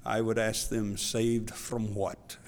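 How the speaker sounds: low, soft imitated African accent. An elder answers his questioner at ordination, in African accent. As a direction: imitate an African accent, slowing on the last phrase. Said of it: "African accent, he said".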